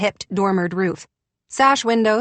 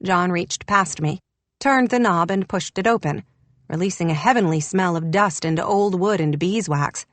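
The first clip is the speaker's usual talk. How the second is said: plain reading voice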